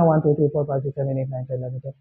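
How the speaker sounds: counting softly to calm down; soft counting to cool off anger; calm slow counting. Shown as a demonstration. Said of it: "you just have to count numbers".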